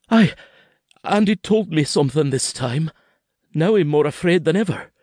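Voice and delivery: put-on high, rushed frightened child voice; a scared little boy pleading with his papa; frightened boy's voice, pitched high; young boy Kenzie. A narrator's reading.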